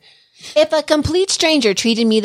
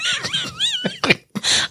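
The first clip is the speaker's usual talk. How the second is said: a high shrieking laugh that falls away; high burst of laughter; 5 dB quieter than usual. Real, not acted. Co-host pulls a face, she shrieks with laughter. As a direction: cracks up at the co-host.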